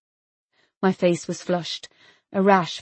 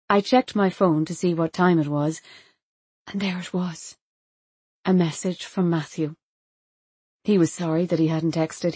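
ordinary delivery

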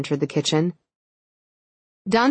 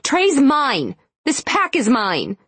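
possessive, raised snarl; angry; 8 semitones higher, 3 dB louder, slower than usual. Acted. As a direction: stake your claim fiercely. Rival claims her mate.

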